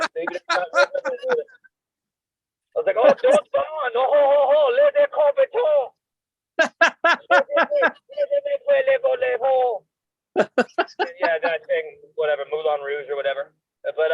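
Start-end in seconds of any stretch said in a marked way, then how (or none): 3.0-5.9 high, hammy mock French accent. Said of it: "doing a ho, ho, ho French accent". 8.1-9.8 laughing. Real, not acted. Laughing after his French bit.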